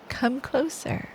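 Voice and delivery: ordinary delivery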